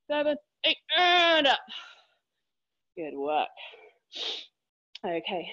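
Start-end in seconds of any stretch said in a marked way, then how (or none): 0.1-1.6 loud. Real, not acted. Pushing through last rep.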